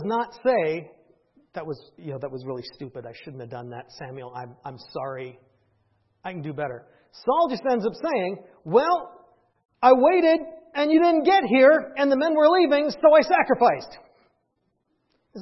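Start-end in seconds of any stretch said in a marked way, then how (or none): 1.5-6.8 very soft, low confession; contrite apology. Acted. Imagined apology Saul never made. 8.6-14.0 loud, higher-pitched excuse; defensive self-justification. Acted. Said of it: "that's just self-justification".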